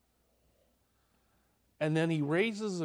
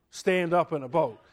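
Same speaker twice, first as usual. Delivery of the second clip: ordinary delivery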